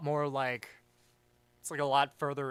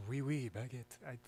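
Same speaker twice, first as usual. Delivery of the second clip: French accent; 2 semitones lower, 9 dB quieter than usual. Shown as a demonstration. Streamer obliging a viewer's request, French accent. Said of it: "Do a French accent".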